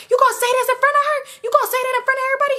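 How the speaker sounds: very high, rushed squeal of protest; hammed-up high-pitched outrage; mock indignant protest. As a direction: imitate an embarrassed man squawking back fast. Embarrassed Chris protesting.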